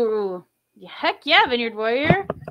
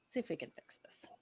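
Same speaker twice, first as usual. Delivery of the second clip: whispering; 6 semitones higher, 29 dB quieter than usual. Real, not acted.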